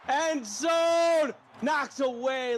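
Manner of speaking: high, loud sudden call; excited call. Real, not acted. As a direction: call the end zone throw. Play-by-play near touchdown.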